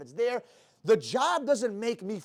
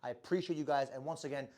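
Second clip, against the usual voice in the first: lower, softer channel sign-off read; quiet outro; 6 semitones lower, 11 dB quieter than usual. Acted. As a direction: wind down and ask them to subscribe, softly. Channel outro, subscribe request.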